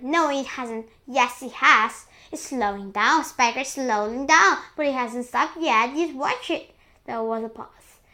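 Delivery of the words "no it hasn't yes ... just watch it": loud back-and-forth; excited arguing. A narrator's reading. Two aunts dispute whether it stopped growing, excited arguing. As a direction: argue in a raised voice. Aunts argue over the peach.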